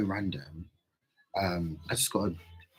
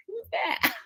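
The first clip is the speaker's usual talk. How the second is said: much higher, cartoonish squeak; squeaky mock voice. Mimicking her reply to the stranger, squeaky voice. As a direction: pipe it up like a startled cartoon. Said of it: "I was just being like".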